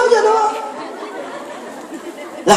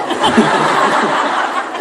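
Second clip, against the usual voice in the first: breathy laugh. Real, not acted. Laughs after demo prayer.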